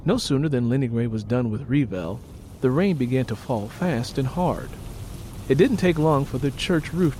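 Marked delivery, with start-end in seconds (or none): none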